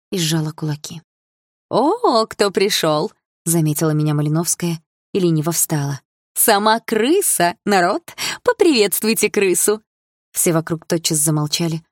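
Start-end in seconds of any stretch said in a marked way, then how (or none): 1.7-3.1 mock surprise. Mocking shout on seeing heroine. 6.3-9.8 higher mocking call to the crowd; mocking taunt. Bully announcing heroine to crowd.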